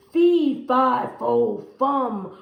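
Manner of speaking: loud, bellowing giant chant; roaring giant. Acted. Angry giant roars chasing Jack down the hall. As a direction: roar it after the fleeing boy. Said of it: "roared that giant".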